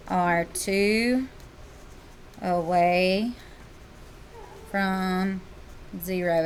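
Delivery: slow, spaced dictation. Shown as a demonstration. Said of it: "teacher spells out slowly to class".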